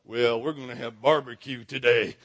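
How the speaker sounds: low, drawling Texan voice. Retold or imitated. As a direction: drop low like a Texan at a cookout. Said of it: "all the".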